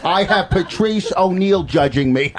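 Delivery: slow, drawn-out comic impression; Rich Voss. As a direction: mimic a whining club comic, stretching the words. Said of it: "All right, we'll do Voss first".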